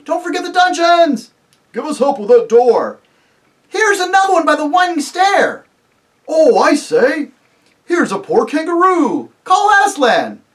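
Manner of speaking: high, loud calls from searchers; excited crying out. Acted. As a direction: shout across the castle to the others. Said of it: "everyone's voices crying out at once".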